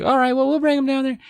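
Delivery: put-on high, fast girly voice; girls' voice. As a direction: chirp it out eagerly. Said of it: "he's like".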